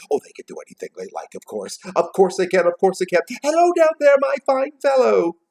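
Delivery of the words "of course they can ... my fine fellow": high, loud, hearty calling. A narrator's reading. Scrooge calling down to the boy, elated. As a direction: babble it, then hail loudly.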